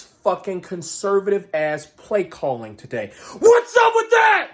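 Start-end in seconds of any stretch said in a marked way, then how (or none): 3.4-4.5 high, loud outraged yell; furious shout. Real, not acted. Fan yelling at coach Steve, outraged high shout. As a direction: bark it at the coach in disbelief.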